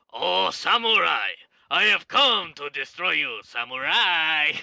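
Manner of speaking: loud, slow, booming villain voice; Aku impression. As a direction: drag out samurai like a gloating demon lord. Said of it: "my best impersonation of the guy who does Aku's voice".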